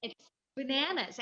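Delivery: usual speaking voice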